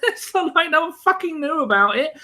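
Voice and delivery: high, laughing incredulity at the obvious; a fan still worked up about the goal; exasperated disbelief. Real, not acted. Exasperated rant over the goal.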